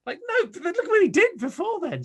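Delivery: high, exasperated protest; exasperated retort. Retold or imitated. Fan protesting to the moaning family, high and exasperated. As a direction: point out the brilliant run.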